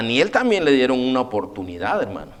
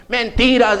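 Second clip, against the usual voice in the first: raised, higher cry of "Mentiras"; prophet's rebuke; 7 semitones higher, 4 dB louder than usual. Retold or imitated. Prophet of God rebuking the king, loud high cry. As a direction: call out the lie with force. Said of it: "dice el profeta de Dios".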